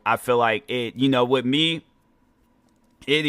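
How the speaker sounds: ordinary talk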